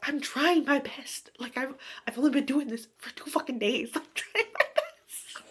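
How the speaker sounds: mock exasperated witch; flustered heroine. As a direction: plead defensively, cracking up. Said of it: "And she's like".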